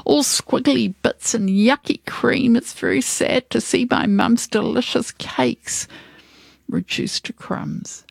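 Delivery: high-pitched lament, fading at the end; dismayed cry. Acted. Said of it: "The baker cried".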